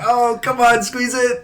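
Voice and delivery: high, teasing put-on voice; mocking coaxing voice. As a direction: egg him on in a silly high tone. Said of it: "it's like".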